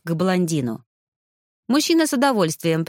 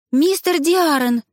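high, stretched-out calling of a name; raised, slow exclamation; surprised call; 9 semitones higher, 4 dB louder, slower than usual. A narrator's reading. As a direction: call out to someone you suddenly recognise. Recognises rider, calls out to him.

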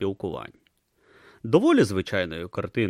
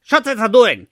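scornful tortoise god; 12 semitones higher, 11 dB louder than usual. Acted. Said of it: "поцікавився Ом".

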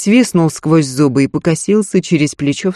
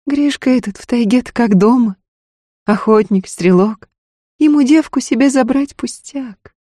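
markedly higher woman's character voice; Устинья, worried wife; 9 semitones higher, 4 dB louder than usual. A narrator's reading. Wife warns Ефим about the hunter Гришка, worried. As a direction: press your warning in a raised pitch.

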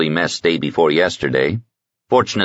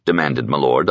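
ordinary delivery, nothing marked

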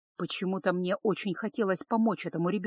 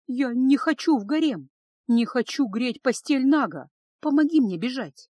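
high, louder, desperate begging; childlike tearful plea; 5 semitones higher, 5 dB louder than usual. A narrator's reading. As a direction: beg like an upset child. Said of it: "по-детски всхлипнула и призналась".

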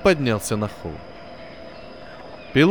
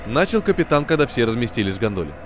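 usual speaking voice

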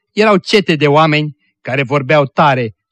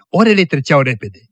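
ordinary delivery, nothing marked

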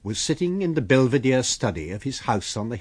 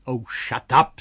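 abrupt, louder rebuke; sudden vigour; 3 semitones higher, 7 dB louder than usual. A narrator's reading. Invisible man silencing the grumbling tramp, sudden vigour. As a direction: cut them off with a burst of force. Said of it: "with sudden amazing vigour".

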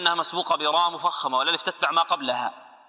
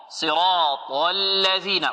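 صراط الذين drawn out slowly; measured tajweed reading; slow Quran recitation; much slower than usual. Shown as a demonstration. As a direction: recite it long and deliberate. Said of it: "teacher recites the verse".

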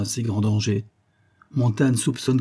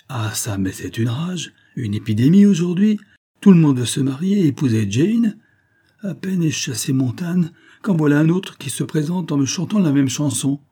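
higher, louder indignant outburst; exasperated; 6 semitones higher, 5 dB louder than usual. A narrator's reading. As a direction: rant like a father fed up with suitors. Father exasperated by marriage proposals.

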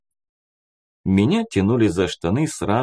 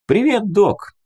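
Abi's greeting from behind; 11 semitones higher, 4 dB louder than usual. A narrator's reading. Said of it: "раздался у меня за спиной голос Аби".

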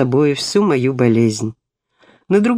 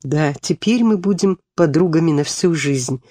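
ordinary delivery

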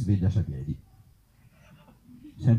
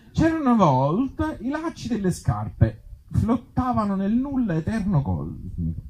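storybook voice; 8 semitones higher than usual. Acted. Absurd fairy-tale opening.

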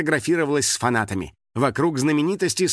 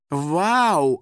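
raised, stretched-out wow; exclaiming; 4 semitones higher, 3 dB louder than usual. A narrator's reading. Star seeing a girl's stained T-shirt, exclaiming. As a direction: exclaim it with a higher voice. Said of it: "воскликнула Лиза Мяу".

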